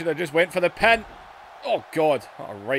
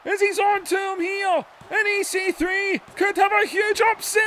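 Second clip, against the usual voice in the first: much higher, shouted play-by-play; excited shout; 11 semitones higher than usual. Real, not acted. Commentator calling a possible upset, rising shout. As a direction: call the upset like the crowd is erupting.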